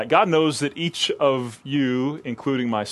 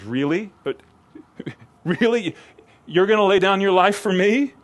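incredulous, higher and louder "really?"; restrained sarcasm; 8 semitones higher, 4 dB louder than usual. Acted. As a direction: ask it as if you can't believe him, half laughing. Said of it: "with restrained sarcasm".

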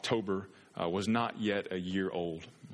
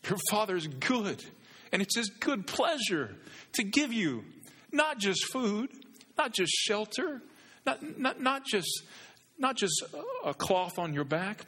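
much higher, slow, ringing emphasis on not; preaching at full force; 12 semitones higher than usual. Acted. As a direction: build each not higher like a crescendo. Climactic preaching, higher, slower.

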